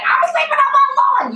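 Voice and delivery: high, loud kooky declaration; eccentric old woman. Acted. Old woman tells people about her lawn, high and loud. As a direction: announce it like a batty old lady.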